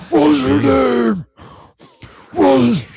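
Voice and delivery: loud, high, drawn-out mock Batman growl; angry Batman impression. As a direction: do an over-the-top angry Batman. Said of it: "gets really angry over everything".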